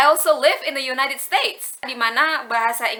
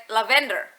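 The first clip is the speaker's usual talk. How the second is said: "lavender" stressed on VEN; "lavender" with the middle syllable leaned on; wrong stress. Retold or imitated. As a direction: say la-VEN-der, as a learner would. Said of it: "the way I described to her".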